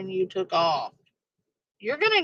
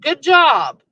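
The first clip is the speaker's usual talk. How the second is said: loud mock congratulation; sarcastic praise; 4 semitones higher, 8 dB louder than usual. Real, not acted. Mocking the fleeing driver with loud fake praise. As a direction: sneer a fake well done.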